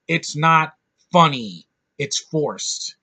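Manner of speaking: ordinary talk, nothing marked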